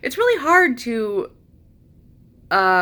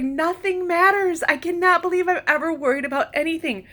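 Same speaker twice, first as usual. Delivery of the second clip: much higher, giddy rush; euphoric wonder; 9 semitones higher than usual. Retold or imitated. Looking up at the stars, high euphoric rush. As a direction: let the awe spill out.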